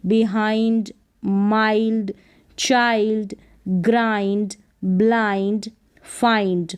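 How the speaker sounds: माइल्ड, चाइल्ड said slowly with a long i sound; drawn-out model pronunciation; slow model words. Shown as a demonstration. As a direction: read each example slowly for learners. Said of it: "इसको behind नहीं पढ़ेंगे".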